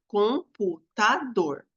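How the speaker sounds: computador spelled out slowly, co-mpu-ta-dor; syllable by syllable. Shown as a demonstration. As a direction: break computador into slow separate syllables. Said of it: "eu vou separar as sílabas".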